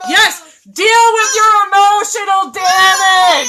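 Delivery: loud, slow, cracking yell; triumphant shout. Real, not acted. Closing her rant to viewers with a big triumphant shout. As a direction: throw the last line out like a battle cry.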